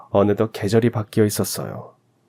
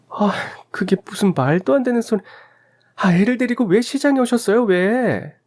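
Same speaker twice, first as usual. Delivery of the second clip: much higher, sharp accusing shout; angry wife; 12 semitones higher than usual. Acted. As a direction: she blames her mother-in-law outright. Wife blames mother-in-law. (시어머니를 탓하며 따지는 며느리)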